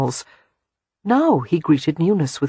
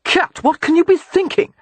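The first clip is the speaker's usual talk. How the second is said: alarmed; 13 semitones higher, 4 dB louder than usual. A narrator's reading. Husband alarmed at babies' biscuits.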